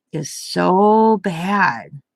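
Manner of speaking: "so bad" stretched very slowly; "so bad" said long and heavy; drawn-out emphasis. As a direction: drag out "so" and "bad" for weight. Retelling the dad's verdict on Milwaukee.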